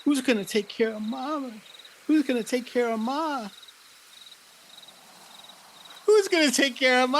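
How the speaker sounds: desperate worry about who cares for Ma; worried for Mama. Acted. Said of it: "really scared that you're lost in the woods".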